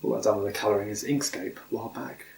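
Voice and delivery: usual speaking voice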